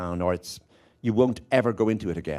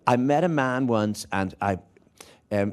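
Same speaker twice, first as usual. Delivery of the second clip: usual speaking voice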